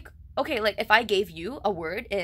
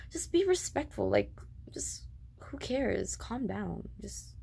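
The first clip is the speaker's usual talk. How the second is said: whisper; 5 dB quieter, slower than usual. Real, not acted. Whispered plea in rant.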